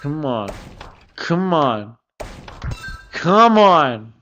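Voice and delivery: usual speaking voice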